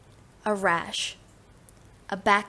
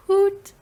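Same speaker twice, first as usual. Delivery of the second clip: short, loud, high hoot; owl hoot; 11 semitones higher, 6 dB louder than usual. Shown as a demonstration. Teacher demonstrating animal sounds, high owl hoot. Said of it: "Animal sounds".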